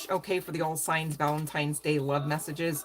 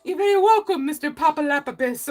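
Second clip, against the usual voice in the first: much higher, louder mock-polite resort worker; resort worker impression; 9 semitones higher, 9 dB louder than usual. Reader imitating the resort guy from Blended, high and loud. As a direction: ham up the over-eager hotel host. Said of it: "He was like".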